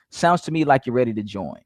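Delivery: "ready to join" said low, falling; low, settled fall through "ready to join"; downswing tone. Shown as a demonstration. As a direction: state it as a command, dropping on "join". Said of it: "start with a downswing tone".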